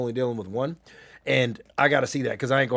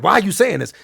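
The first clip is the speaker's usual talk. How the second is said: louder, higher mock indignation; imitating angry mom; 4 semitones higher, 6 dB louder than usual. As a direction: retell her mad comeback, raised voice. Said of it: "she was so mad".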